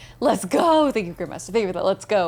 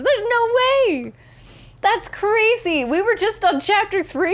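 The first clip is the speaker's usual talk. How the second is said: high-pitched, stretched-out incredulity; astonished, high and drawn out; real disbelief; 8 semitones higher than usual. Real, not acted. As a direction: protest high, as if it cannot be true. Reacting to nearness of game's end.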